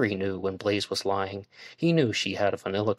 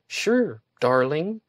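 higher, louder, sarcastic sweet reply; sarcastic retort; 7 semitones higher, 4 dB louder, much slower than usual. A narrator's reading. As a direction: drip fake sweetness on it. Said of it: "she retorted almost sarcastically".

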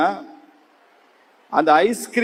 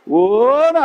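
long, loud rising mock bawl; mock wail; 4 semitones higher, 6 dB louder, much slower than usual. Storyteller shows lost boy starting to cry, loud mock wail. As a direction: stretch the vowel into an imitated child's howl. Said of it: "அழ ஆரம்பிச்சிட்டான்".

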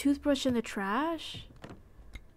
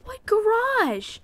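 surprised; 10 semitones higher, slower than usual. Real, not acted. Confused exclamation.

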